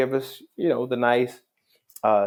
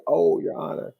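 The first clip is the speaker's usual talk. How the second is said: high-pitched mock dawning recognition; retold realization; 8 semitones higher than usual. Young man realizing he's talking to a judge, startled. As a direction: imitate someone suddenly seeing who they addressed. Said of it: "he kinda stepped back like".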